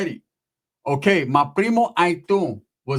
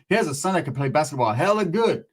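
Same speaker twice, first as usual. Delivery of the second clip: usual speaking voice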